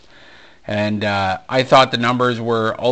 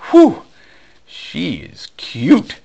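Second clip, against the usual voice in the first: high "whew", then slow, drawn-out admiration; admiring reaction; 6 semitones higher, 5 dB louder, much slower than usual. Retold or imitated. Man reacting as a model walks by, slow admiration. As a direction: imitate being bowled over, stretching each word out. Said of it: "then immediately I go".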